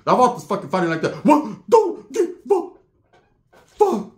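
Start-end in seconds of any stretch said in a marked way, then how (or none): 1.2-4.1 high, punched-out fight yelps; mimicked wild fighter. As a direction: act out throwing blows with each word. Imitates vato fighting wildly.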